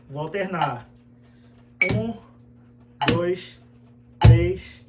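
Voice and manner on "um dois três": counting in time. Shown as a demonstration. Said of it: "alternando nesse exercício que a gente fez".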